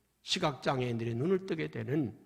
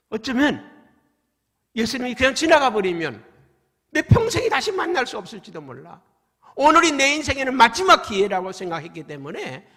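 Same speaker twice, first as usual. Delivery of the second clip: urgent pleading; 7 semitones higher, 11 dB louder than usual. Acted. Preacher voices blind man's urgency.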